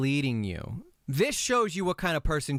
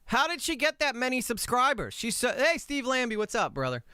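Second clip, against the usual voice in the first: high energy streamer voice; 9 semitones higher than usual. Shown as a demonstration. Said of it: "that monotone voice".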